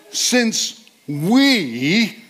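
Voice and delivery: we lifted high and leaned on; stress on we. Shown as a demonstration. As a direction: hit we hard and high. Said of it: "See if you can notice what words I emphasize".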